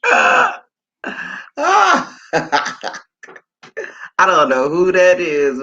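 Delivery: laughing through the words, slow. Real, not acted. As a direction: giggle it out slowly. Laughing at a strange comment.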